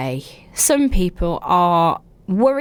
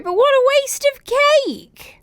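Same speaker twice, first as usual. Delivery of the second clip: higher, slow, sing-song mock grief; mock lament. Real, not acted. Host mock-mourning the wasted birthday cake, slow and high. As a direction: wail it like a tragedy.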